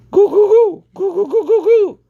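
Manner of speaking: very high, loud goo goo call; a shrill farmyard chicken call; hen call. A narrator's reading. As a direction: call the hen at the top of your voice. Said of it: "she called at the top of her voice".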